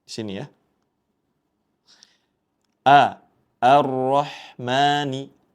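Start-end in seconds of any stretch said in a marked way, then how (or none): 2.9-5.4 Ar-Rahmani with the opening A light and thin, slow. Shown as a demonstration. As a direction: keep the first A of Ar-Rahmani thin, not heavy. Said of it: "Jangan sampai dibaca tebal".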